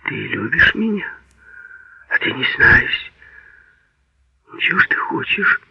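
intimate, hesitant whispered questions. A narrator's reading. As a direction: ask it under the breath, barely voiced. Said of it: "всё спрашивала его шёпотом".

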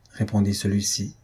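ordinary delivery, nothing marked

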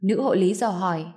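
normal talking voice

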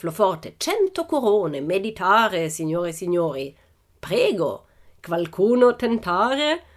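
put-on high-pitched ringmaster with foreign accent; slow, theatrical showman in broken Italian; circus director. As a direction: throw out a grand challenge, drawing out key words. Director challenges the audience.

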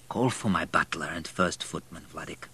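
lightly voiced failing old master; quiet command trailing away; weak dying baron. A narrator's reading. Dying Baron gives an order.

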